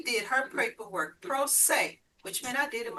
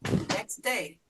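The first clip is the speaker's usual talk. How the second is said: ordinary talk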